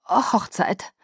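hesitant, halting word after a pause; haltingly forced-out word; barely getting it out. A narrator's reading. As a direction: struggle to get the word out. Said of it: "brachte das Wort kaum heraus".

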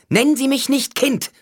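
high, loud furious snap; angry snap. A narrator's reading. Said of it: "fauchte sie". (wütend fauchend, laut und hoch)

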